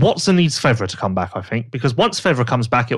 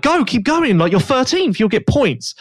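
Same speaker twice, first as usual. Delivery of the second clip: half-shouted urging, much higher; retold shouting; 10 semitones higher, slower than usual. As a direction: imitate a team urging the rider on, voice raised in pitch. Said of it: "the team was shouting at him".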